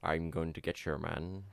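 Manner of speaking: lightly voiced Sean Connery, low and soft; a hint of Sean Connery drawl; Sean Connery impression. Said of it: "that's Sean Connery now".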